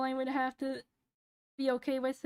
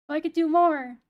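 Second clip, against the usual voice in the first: more shouted, falling hard; loud emphatic; 2 semitones higher, 7 dB louder than usual. Shown as a demonstration. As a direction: punch out more loudly. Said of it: "so I could do more in a day".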